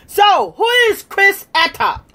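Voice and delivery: triumphant shouted "who is Chris Atok"; challenging shout. Real, not acted. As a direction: throw the question at him like a dare.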